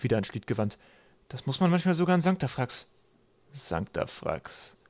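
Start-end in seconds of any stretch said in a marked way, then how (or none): 1.3-2.9 high-pitched character line; small crewmate's voice. A narrator's reading. Kaukab's voice acted in story. 3.5-4.8 low, very slow, soft murmur; wistful murmur. A narrator's reading. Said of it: "murmelte Schliet".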